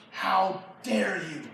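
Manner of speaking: slow, measured indignation; contained outrage. Acted. Saul rebuking his son Jonathan, slow contained outrage. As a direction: hold the anger in and space each word.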